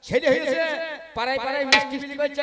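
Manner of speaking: emphatic repeat. Real, not acted. Repeats it after asking audience.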